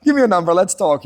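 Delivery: mock casual come-on; eager flirting. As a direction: play it cool while clearly keen. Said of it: "And I was like".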